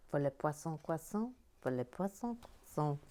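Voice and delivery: 'le poisson croissant' in a mock French accent; a light French-accented 'le poisson croissant'. Shown as a demonstration. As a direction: roll 'poisson croissant' the French way. Reading French cafe name.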